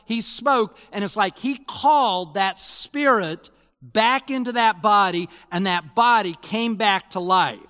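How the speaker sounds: high, loud, fervent sermon voice; impassioned pulpit delivery; full-force preaching. Acted. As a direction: preach it out with rising conviction.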